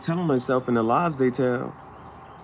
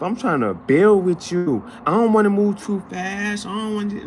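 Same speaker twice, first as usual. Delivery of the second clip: mock their fake promises; mock sweet talker; 10 semitones higher, 6 dB louder than usual. Fake friend promising loyalty, mock sweet talker. Voicing sweet-talking people.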